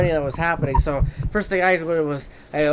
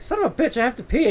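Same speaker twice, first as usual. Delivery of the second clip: frustrated outburst; 8 semitones higher, faster than usual. Retold or imitated. Retelling being beaten to the bathroom, high exasperated squeal. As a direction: squeal it in mock outrage.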